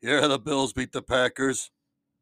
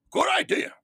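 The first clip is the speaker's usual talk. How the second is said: jolly Santa; 5 dB louder than usual. Acted. Santa answers with laugh.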